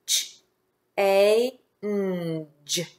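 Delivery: isolated phonics sounds, "G" as a short j; tapping the sounds. Shown as a demonstration. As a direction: say each sound of "Ch, A, N, G" alone. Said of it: "Let's tap the sounds".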